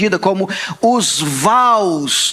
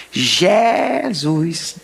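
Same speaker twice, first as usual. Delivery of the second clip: "Jesus" drawn out the Northeastern way; Northeastern accent; 3 semitones lower than usual. Retold or imitated. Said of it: "ele fala como". ("Jesus" com sotaque nordestino)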